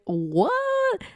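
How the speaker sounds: very high, long stretched what; shocked what. Retold or imitated. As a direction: shriek the word in disbelief. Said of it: "I'm just like".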